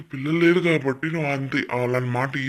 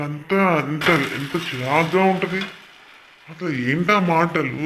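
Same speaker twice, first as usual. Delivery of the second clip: disgusted angry outburst; 4 semitones higher, 6 dB louder, slower than usual. Real, not acted. Ranting about vulgar talk.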